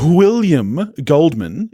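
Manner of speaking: mock-proper "William Goldman", slow and drawn out; correcting himself to the grand full name, slow and mock-formal. Mock-formal full name. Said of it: "Is that better?".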